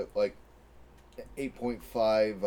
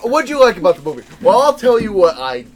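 loud, high, fast mimicry of a friend; mocking imitation of friend; 8 semitones higher, 13 dB louder than usual. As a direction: parody him, loud and laughing. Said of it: "No, he's like".

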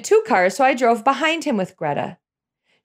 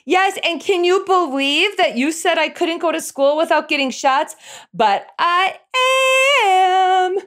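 sing-songy; 7 semitones higher than usual. Retold or imitated. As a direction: tease it like a smug little kid. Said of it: "in a sing-songy voice".

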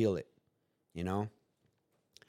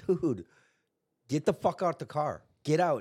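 angry yell; 5 semitones higher than usual. Retold or imitated. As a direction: bark it at her to get out. Yelling at girlfriend in car.

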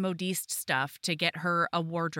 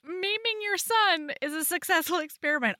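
mock disbelief; 9 semitones higher than usual. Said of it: "And I'm like".

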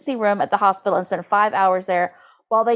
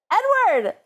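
gleeful call; 12 semitones higher than usual. Acted. Gleefully calling out the editor's name.

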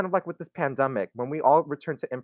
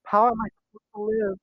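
quick, higher, hammed-up wail of despair; mock despair; 7 semitones higher, much faster than usual. Joking to friends about losing SNL impressions, mock despairing. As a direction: play the loss like a tragedy, tongue in cheek.

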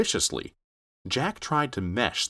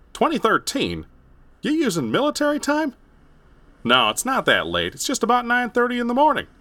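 high, incredulous man's voice; 5 dB louder than usual. Acted. Ted baffled.

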